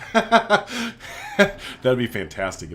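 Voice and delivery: slow words through a laugh; drawn-out, laughing self-mockery. Real, not acted. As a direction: laugh at your own blunder. Laughing at own mistake.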